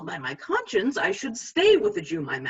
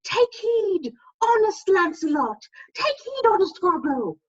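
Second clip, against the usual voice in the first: much higher pleading conscience voice; 11 semitones higher, 3 dB louder than usual. Retold or imitated. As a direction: warn him in a piping little voice. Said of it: "My conscience says".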